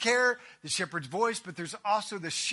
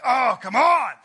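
loud, pitched-up groaning complaint; mock exasperation; 4 semitones higher, 11 dB louder than usual. Owner to muddy indoor dog, loud exasperation. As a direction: protest loudly, hammed-up annoyance.

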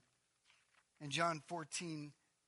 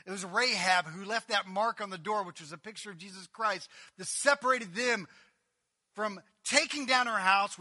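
louder, higher preaching; 4 semitones higher, 12 dB louder than usual. Acted. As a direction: lift it to the room.

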